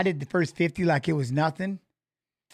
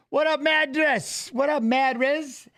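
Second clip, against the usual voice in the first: calling out; 7 semitones higher, slower than usual. Real, not acted. Greeting a chat viewer.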